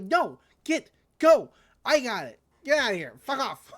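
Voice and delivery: mock furious brush-off; shooing them off. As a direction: chase them out angrily. Boss shooing rangers away.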